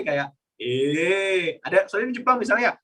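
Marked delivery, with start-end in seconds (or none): 0.6-1.6 "Eh" stretched long; long, held "Eh"; drawn-out intonation. Shown as a demonstration. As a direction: drag out "Eh" in one long pull. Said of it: "kayak ada yang ditarik".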